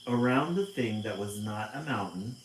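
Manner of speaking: loud, slow read-aloud storytelling; storybook narration. A narrator's reading. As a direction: paint the scene for the kids. Picture book read aloud.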